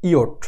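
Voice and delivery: ইয়ট said as the German J, short; teacher modelling ইয়ট for learners; German letter sound. Shown as a demonstration. German J letter name. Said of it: "জে এর উচ্চারণ".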